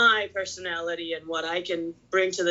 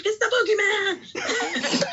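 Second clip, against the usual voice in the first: high thrilled fan squeal; excited shout; slower than usual. Retold or imitated. Retold fan excitement meeting idol.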